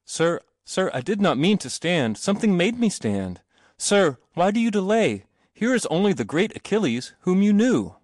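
high-pitched pleading call; urgent appeal. A narrator's reading. Boy urging his hesitant companion out, urgent appeal. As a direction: urge him on, voice kept high.

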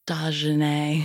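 slow, drawn-out "Dagenais"; deliberately mangled name, said slowly; misheard name. Retold or imitated. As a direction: imitate a half-deaf dad proudly garbling Desiree as "Dagenais". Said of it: "My dad's also fifty percent deaf".

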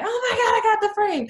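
high, loud hype-up squeal; pumping someone up. Retold or imitated. Photographer pumping up her client mid-shoot, excited squeal. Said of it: "pumping you up".

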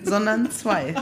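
real laughter breaking through the words; talking through giggles; laughing. Real, not acted. Laughing through parking-ticket story.

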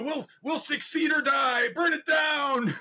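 high, loud, slow rallying shout; mock battle cry. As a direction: roar it like a reckless war cry. Imitating Joe's wild energy.

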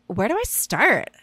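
put-on airy Valley girl question. Shown as a demonstration. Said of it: "I'll just say it like a Valley girl".

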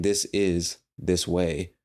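"This is this way" said slowly and evenly; slow, deliberate model sentence; slow example phrase. Shown as a demonstration. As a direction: lay out "This is this way" word by word. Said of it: "Instead of saying".

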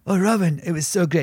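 high, breathless gush to a star; mock-starstruck, voice jumping up; gushing fan. Actor retelling meeting Robin Williams, high starstruck gush. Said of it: "And I was like".

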